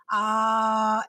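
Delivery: smooth, flowing held "ah"; "ah" flowing out freely on the breath; easy onset. Shown as a demonstration. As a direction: release the air with the voice on "ah". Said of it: "because you are releasing your air".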